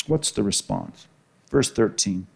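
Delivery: normal talking voice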